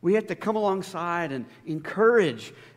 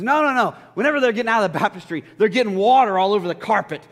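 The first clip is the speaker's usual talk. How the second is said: louder, fast, fussy protest; indignant objection; 3 semitones higher, 7 dB louder than usual. Retold or imitated. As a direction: play the upset elder's wife. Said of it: "She says".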